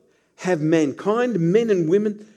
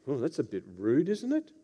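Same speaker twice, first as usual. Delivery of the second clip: mock offended; 5 semitones lower, 9 dB quieter than usual. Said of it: "That's what they would think".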